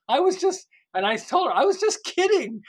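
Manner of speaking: high, loud, squeaky mock protest; sky-high joking denial; laughing protest. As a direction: yelp it out, half laughing. Telling her it was a joke.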